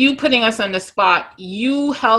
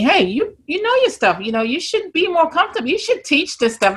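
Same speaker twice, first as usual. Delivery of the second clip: higher-pitched, lightly voiced urging colleague; encouraging fellow agent; 6 semitones higher than usual. Other agents urging her to teach, encouraging, lightly imitated. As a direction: lightly act out a colleague's pep talk. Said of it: "other agents say to me".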